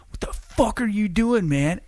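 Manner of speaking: yelling at a stranger caught smoking; teen yelling "what the fuck"; angry yell. Retold or imitated. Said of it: "and I was like".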